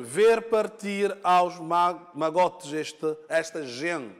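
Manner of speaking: "gente" as the end stress, the line spelled out slowly; a teacher counting syllables aloud, stressing "gente"; slow syllable scansion. Shown as a demonstration. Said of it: "aqui está a sílaba tônica".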